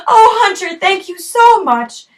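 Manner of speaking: high loud tearful relief; laughing through tears. Acted. Rescued girl thanks hunter.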